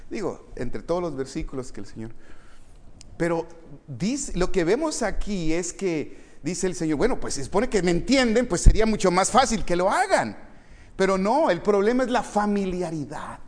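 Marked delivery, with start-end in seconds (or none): 3.2-13.4 high, raised sermon voice; emphatic preaching. Acted. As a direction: drive the point home from the pulpit. Preaching at full force.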